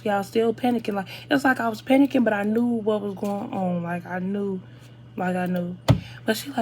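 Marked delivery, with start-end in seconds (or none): none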